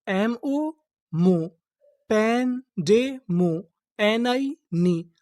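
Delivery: very slow, syllable by syllable. Shown as a demonstration. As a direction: spell it out slowly for the class. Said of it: "syllable-by-syllable reading demo". (धीरे-धीरे अक्षर-अक्षर पढ़ना)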